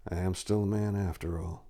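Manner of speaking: soft, quiet sorrowful murmur; a touch of sorrow. Acted. Knight to himself, quietly and sadly. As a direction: sigh it out in regret.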